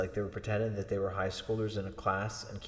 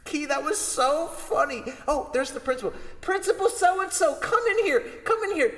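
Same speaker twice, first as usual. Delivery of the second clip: much higher, delighted teacher voice; gushing teacher; 4 dB louder than usual. Retold or imitated. Teacher calls the principal in to hear the joke, high. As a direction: call the principal over gleefully.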